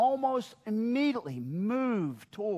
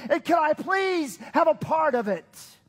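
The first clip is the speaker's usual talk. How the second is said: impassioned plea; 7 semitones higher, 6 dB louder than usual. Acted. Passionate pleading prayer climax.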